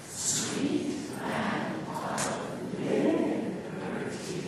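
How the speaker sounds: drawn-out unvoiced whisper, very slow. Shown as a demonstration. Teacher demonstrating a slow whisper to a toddler. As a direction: whisper each word slowly to the baby.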